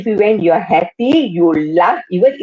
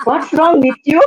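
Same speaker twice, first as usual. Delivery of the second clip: panicked; 6 semitones higher than usual. Retold or imitated. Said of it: "My husband was so panicked".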